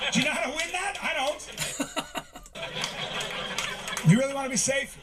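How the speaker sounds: high mock voice. High-pitched impression continues.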